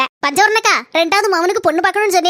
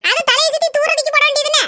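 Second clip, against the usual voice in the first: higher-voiced worried village wife scolding her husband; 5 semitones higher than usual. Village wife worrying over the sick cow and scolding her husband. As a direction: play the fretting wife, a little higher.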